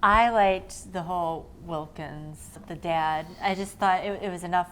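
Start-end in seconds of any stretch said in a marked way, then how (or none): none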